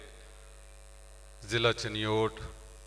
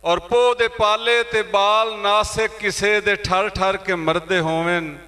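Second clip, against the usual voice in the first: high, ringing openings that descend at each close; impassioned poetry recitation; 6 semitones higher, 6 dB louder than usual. Acted. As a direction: declaim the verse to the whole hall. Recites verse at mushaira. (ਜੋਸ਼ ਨਾਲ ਉੱਚੀ ਸੁਰ ਵਿੱਚ ਸ਼ਾਇਰੀ)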